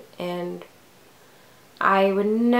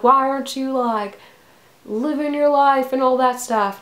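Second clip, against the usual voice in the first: higher, louder mock Southern voice; bad Southern accent; 5 semitones higher, 5 dB louder than usual. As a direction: nag in a deliberately terrible Southern accent. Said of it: "doing this in a really shitty southern accent".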